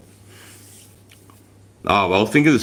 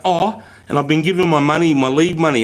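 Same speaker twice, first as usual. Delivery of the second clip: ordinary talk, nothing marked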